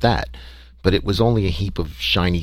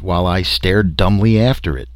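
ordinary delivery

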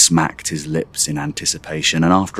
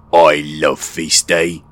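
father voice; slower than usual. Acted. Father voice, slow.

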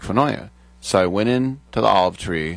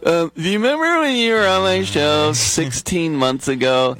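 much higher, sheepish fan voice reminding someone; timid reminder; 11 semitones higher than usual. Retold or imitated. Fan nudging a comic who once did his show, squeaky and sheepish. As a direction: play it small and hopeful, pitched way up.

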